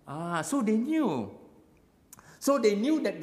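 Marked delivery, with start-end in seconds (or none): none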